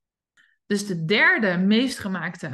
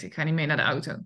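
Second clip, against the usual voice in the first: stubborn dog; 6 semitones lower, much faster than usual. Pretend. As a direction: mutter it as a dog refusing.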